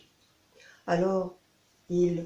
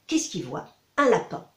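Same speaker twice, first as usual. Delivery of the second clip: ordinary delivery, nothing marked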